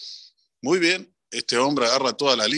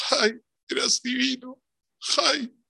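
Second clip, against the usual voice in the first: high, wailing fake lament, much higher pitch; mock mourning; 10 semitones higher than usual. Mourners praise the dead man, hammed-up wail. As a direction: overdo the grief at the funeral.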